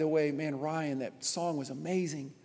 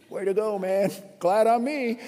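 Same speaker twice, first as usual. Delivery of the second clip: loud, higher, smug pat on the back; mock self-congratulation; 4 semitones higher, 6 dB louder than usual. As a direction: preen at yourself, tongue in cheek. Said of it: "'Cause I was like".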